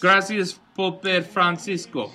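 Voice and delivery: mock Spanish accent, slow and lilting; slow, playful Spanish accent. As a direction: say it like a Spanish speaker, drawing it out. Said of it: "That was a pretty good, uh, accent there".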